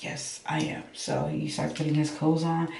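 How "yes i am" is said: whispered stubborn insistence. Retold or imitated. Whispering back to the friend that she will sleep. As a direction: hush it out, digging in.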